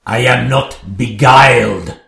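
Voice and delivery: usual speaking voice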